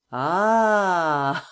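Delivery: loud burst of an exclamation; triumphant cry. A narrator's reading. Lupin to Kesselbach on the phone, a loud gleeful cry. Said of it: "cried Lupin, shouting with laughter".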